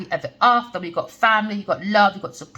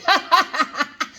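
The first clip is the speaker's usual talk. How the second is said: high burst of giggles; real laugh; 8 semitones higher than usual. Real, not acted. Embarrassed by her Jamaican accent attempt, real laughter. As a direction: crack up at your own silliness.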